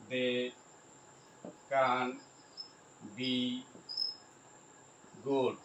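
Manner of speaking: isolated words with long pauses. Shown as a demonstration. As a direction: dictate one word at a time. Spelling out example while writing.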